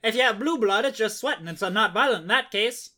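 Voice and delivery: put-on hammy old-fashioned narrator voice; old-timey voice. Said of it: "like, an old-timey voice".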